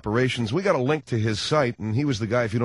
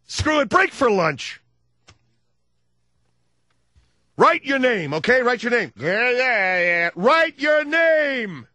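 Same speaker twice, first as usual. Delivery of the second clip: hammed-up frazzled teacher; exasperated teacher; 11 semitones higher than usual. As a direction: lose patience with a pupil.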